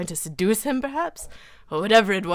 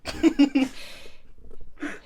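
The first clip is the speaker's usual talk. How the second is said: silent wheezing laugh; breathy laugh; 7 semitones higher than usual. Real, not acted. Reader breaks down laughing at the scene, wheezing. Cracks up reading.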